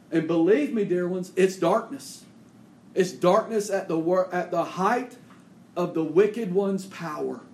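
loud, high preaching at full force, slowing to stress; fiery preaching. Real, not acted. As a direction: thunder it from the pulpit. Preacher builds again.

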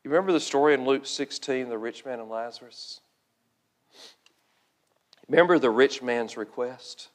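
low, very soft pulpit appeal; hushed and grave, leaving long pauses; solemn preaching. Real, not acted. Preacher urging the saved to pray for laborers, hushed and solemn.

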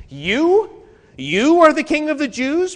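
high, loud disbelieving question; incredulous question. Shown as a demonstration. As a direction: ask it as if you cannot believe it. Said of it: "The sense is".

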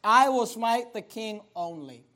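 usual speaking voice

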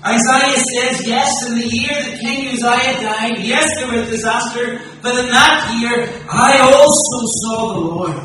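loud, high, ringing preacher's build to a climax; impassioned pulpit proclamation, every line driven hard; full-force preaching. Acted. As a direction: preach it out to the whole hall.

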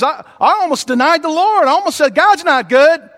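rising despairing questions; anguished doubt. Retold or imitated. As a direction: voice a believer on the edge of giving up. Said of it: "what he meant by that is".

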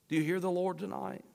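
usual speaking voice

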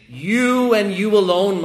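"you" hit loud and high, slowed down; emphatic you. Shown as a demonstration. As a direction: pound each "you" like a finger pointing. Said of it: "it's an emphatic "you".

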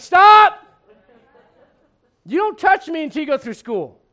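very high, loud yelled stop; mock alarm at the surgeon; panicked shout. Patient yelling at the untrained surgeon, panicked. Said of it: "you'd be like".

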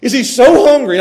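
normal talking voice

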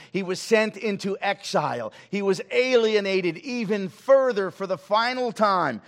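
loud, high, slow pulpit cry; fervent revival preacher shouting the gospel; preaching at full force. Acted. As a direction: build to a thundering sermon peak. Preaching climax.

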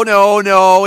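ordinary delivery

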